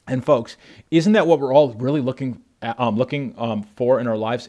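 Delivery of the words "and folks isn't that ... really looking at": normal talking voice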